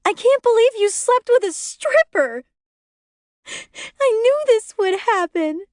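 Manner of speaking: high, loud hysterical shriek on the edge of tears; hysterical screaming. A narrator's reading. Pregnant wife screaming at her husband. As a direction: scream it, beside herself. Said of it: "She's hysterical, crying, screaming".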